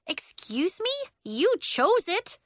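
high, incredulous retort, slowing on the end; can you believe this girl; indignant kid protesting. Acted. Nori indignant at Willa.

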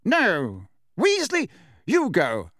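curt command, high on the name; brusque official bark; barked order. A narrator's reading. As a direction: order an underling off sharply. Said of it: "Fudge barked at Percy".

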